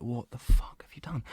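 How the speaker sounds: mock-appalled whisper to himself; whispered self-scolding. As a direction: whisper it as a retold telling-off. Retold panicked scolding of himself.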